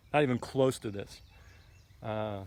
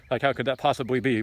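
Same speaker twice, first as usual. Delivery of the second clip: ordinary talk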